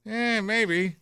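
slow setup, then a high noncommittal "Eh, maybe"; mock hedging. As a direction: repeat it slowly, then waver comically upward. Asked to repeat, jokingly hedges verdict.

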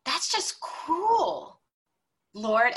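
high, very slow, drawn-out lament; retold cry of grief toward heaven; anguished plea to God. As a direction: stretch out "cruel" in pained disbelief. Grieving complaint to God.

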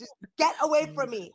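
high, fast, exasperated plea; acted exasperation. Woman shooing men away from her, acted exasperation. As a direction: drop from a yell into fed-up pleading. Acted angry reply, easing from yell.

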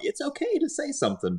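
mock coaxing. Mocking the quiet character.

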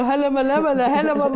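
making fun of mom's ranting; mocking mother's Arabic. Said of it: "hab meine Mutter nachgemacht, hab mich lustig".

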